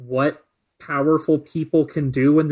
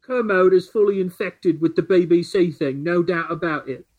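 put-on British accent; 2 semitones higher than usual. Host reads the Boy Jones tweet aloud, light British accent. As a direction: read the tweet as an indignant Brit, British accent. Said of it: "you have to do it in a British accent".

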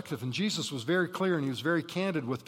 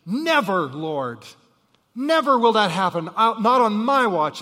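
shouted, high refusal; loud protest; 4 semitones higher, 10 dB louder than usual. Acted. Peter protesting to Jesus, loud shouted refusal. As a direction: refuse it with all your might.

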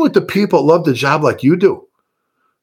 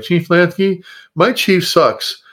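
put-on higher-pitched, childish complaining; whiny voice; 5 semitones higher than usual. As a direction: whine like a kid tattling on the boss. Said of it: "this is my whiny voice".

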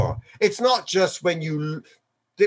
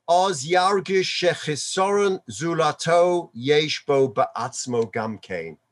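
ordinary talk

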